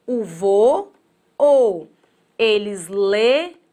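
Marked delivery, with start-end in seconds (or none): 0.0-1.9 "O vo, o" with each syllable said apart; split into syllables. Shown as a demonstration. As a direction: break "voo" into "vo" and "o". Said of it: "Quando eu falo o voo dos pássaros".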